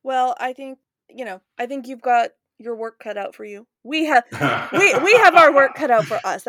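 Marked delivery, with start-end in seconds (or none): 3.8-6.5 loud emphatic. Real, not acted. Joking emphatic declaration.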